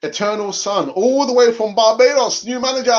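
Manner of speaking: high, loud announcer-style shout; hyped shout-out. Real, not acted.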